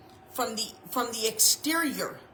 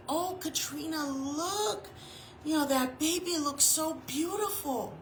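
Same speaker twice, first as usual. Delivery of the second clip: higher, gushing fake compliment; mock gushing flatterer; 6 semitones higher, 5 dB quieter than usual. Someone fishing for praise of their baby, in a mock gushing voice. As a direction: coax her into praising the baby. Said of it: "if somebody tries".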